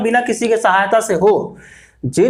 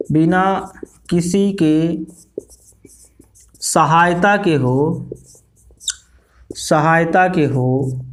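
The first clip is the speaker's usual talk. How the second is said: slow dictation; 3 semitones lower, slower than usual. Shown as a demonstration. Teacher dictating slowly to class.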